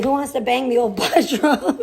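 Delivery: laughing announcer. Retold or imitated. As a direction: call it out while laughing. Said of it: "I say".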